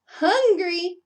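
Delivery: high, loud, slow call; yell. Acted. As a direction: stretch it out for the kids. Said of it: "yelled Bullfrog".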